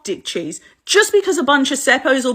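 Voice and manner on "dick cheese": ordinary delivery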